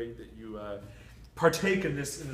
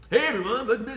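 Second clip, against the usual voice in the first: big, high, loud morning radio host; 9 semitones higher, 11 dB louder than usual. Pretend. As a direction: boom it out like a morning DJ. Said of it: "my radio morning D J voice".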